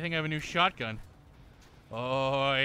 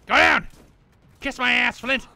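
loud, higher command; shout; 8 semitones higher, 10 dB louder than usual. Real, not acted. Gamer yelling at enemy Flint to die, loud shout. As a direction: bark it at the enemy.

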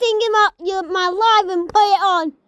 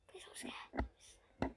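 breathless frightened whisper, slow; scared whisper; 27 dB quieter, much slower than usual. Real, not acted. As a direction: whisper the plea, dreading the scare. Frightened plea during jump scare.

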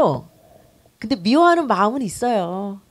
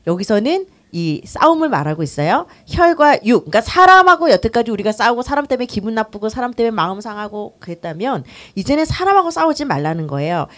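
normal talking voice

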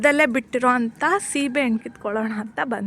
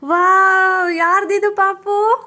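mock amazement; 5 semitones higher, 6 dB louder, much slower than usual. Parent marvels at the doll.

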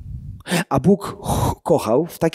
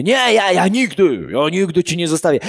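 Peter protesting; 3 semitones higher than usual. Acted.

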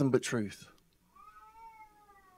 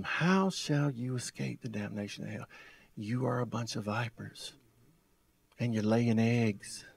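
much softer, low warning; hushed grave rebuke; 6 semitones lower, 10 dB quieter than usual. Retold or imitated. Preacher voicing the Lord warning the Pharisees, hushed and grave. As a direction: let the judgment sink in quietly.

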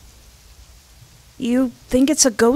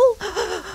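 a sharp, quiet intake of breath; soft frightened gasp; 10 semitones higher, 5 dB quieter than usual. Acted. Sonny gasps at the ghost story, soft and scared. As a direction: gasp in fright, barely voiced.

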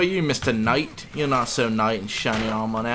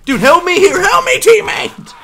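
high desperate shout; yelling for help; 12 dB louder than usual. Real, not acted. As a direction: scream for backup. Calling for help in fight.

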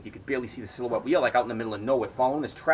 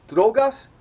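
high, loud sheepish "Drogas?"; sheepish question; 10 semitones higher, 8 dB louder than usual. Retold or imitated. Facing men with knives, high nervous ask. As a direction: offer it as a nervous password. Answering armed men, asking for drugs.

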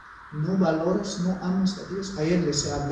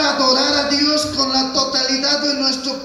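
high, loud impassioned sermon shout; full-force preaching; 9 semitones higher, 7 dB louder than usual. Acted. Preacher recalling first love for God to the congregation, shouted preaching.

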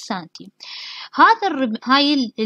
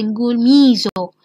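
ميزو said slowly and louder, each syllable drawn out; stressing the new term; 4 dB louder, slower than usual. Shown as a demonstration. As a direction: hammer home the word ميزو so students remember it. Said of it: "اللارج انتستين ما نقول ميزنتري". (التشديد على كلمة ميزو ببطء وبصوت أعلى)

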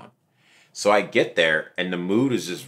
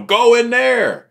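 high, loud and slow shove; mock cowardly order; 8 dB louder, much slower than usual. Coward pushing another man ahead, loud order. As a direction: command someone else to go first.

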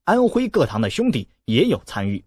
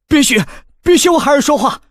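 much higher and louder cry; alarmed shout; 12 semitones higher, 7 dB louder than usual. A narrator's reading. A horrified mother shouts to stop the mockery, high alarmed cry. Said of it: "叫道".

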